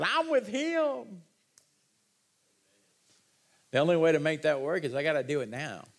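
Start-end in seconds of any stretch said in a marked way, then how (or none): 0.0-1.2 high, slow cry of joy, with a far-off sound; overjoyed shout of belonging; joyful scream. Retold or imitated. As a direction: cry it out to heaven, drawn out. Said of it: "I'm gonna scream out".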